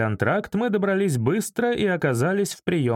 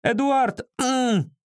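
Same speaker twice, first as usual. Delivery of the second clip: high, urgent call of a name; frantic woman yelling down the phone; straining phone caller; 10 semitones higher, much slower than usual. A narrator's reading. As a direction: call out sharply, pitched way up. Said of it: "надрывалась трубка".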